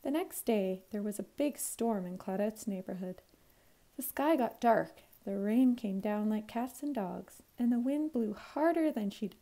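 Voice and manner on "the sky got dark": plain reading voice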